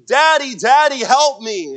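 put-on high, loud child's cry for daddy; child calling for help. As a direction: play a stuck little boy shouting for help. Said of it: "he starts calling out for help".

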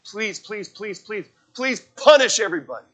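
acted frantic pleading, building to the end; mock begging. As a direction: beg with repeated pleases, as a parody. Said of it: "He's not saying".

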